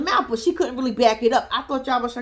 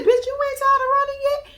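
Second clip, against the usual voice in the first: much higher, teasing retort; mocking jab; 12 semitones higher than usual. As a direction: poke fun at her, pitch up. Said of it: "I said".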